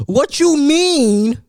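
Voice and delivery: slow, drawn-out indignant question; mock outrage, stretched out slowly; stunned disbelief. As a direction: ask it as if you can't believe a four-year-old said it. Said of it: "I'm used to going to sleep".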